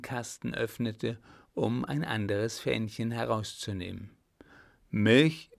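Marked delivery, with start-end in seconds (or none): 4.9-5.5 loud, indignant one-word question. A narrator's reading. Clown claims his right over her, loudly indignant. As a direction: throw it back, affronted. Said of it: "meinte er erbot".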